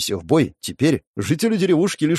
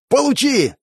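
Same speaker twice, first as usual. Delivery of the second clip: shout; 12 semitones higher than usual. Acted. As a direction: yell as you shoot. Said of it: "крикнул мужчина вдалеке". (боевой выкрик)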